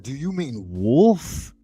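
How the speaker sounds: pointed, drawn-out question on wolf; correcting. Retold or imitated. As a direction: stretch wolf out with its L sounded. Retold correction of girlfriend.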